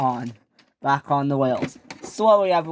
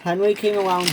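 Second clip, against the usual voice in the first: ordinary delivery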